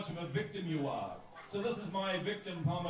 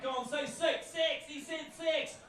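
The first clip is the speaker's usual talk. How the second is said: high-pitched teasing jeer; mocking heckler; 8 semitones higher, slower than usual. Poet mimics crowd goading him over his accent, mock jeering. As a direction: tease the accent, drawn out and higher.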